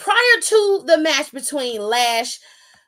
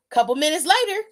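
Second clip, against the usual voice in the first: usual speaking voice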